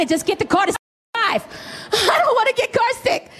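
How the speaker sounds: high-pitched whiny outburst; hammed-up pleading, climbing high; mock exasperation. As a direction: play a carsick wife at wits' end. Acted exasperated wife pleading.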